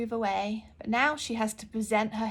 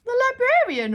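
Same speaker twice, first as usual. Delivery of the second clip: high, loud shouted answer; shouting; 10 dB louder than usual. Acted. Librarian shouts her name to the girl, shouting. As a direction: shout it out proudly. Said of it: "she shouted".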